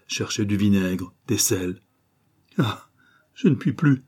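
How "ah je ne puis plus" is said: higher, breathy, worn-out plea near tears; exhausted murmur. Acted. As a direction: let her voice give out. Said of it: "avec un accent d'indicible épuisement".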